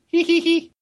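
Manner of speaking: squeaky fake hee-hee; silly high giggle. Co-host ending a joke goodnight, silly squeaky giggle. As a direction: tack on a very high put-on titter.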